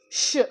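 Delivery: "ష్" as one single hushing sound; digraph sound. Shown as a demonstration. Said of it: "ఒక సౌండే వచ్చింది". ("ష్" ఒకే శబ్దంగా)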